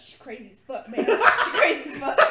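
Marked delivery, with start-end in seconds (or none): 1.1-2.3 laughing it out; blurted through laughter. Real, not acted. Cracking up about coworker.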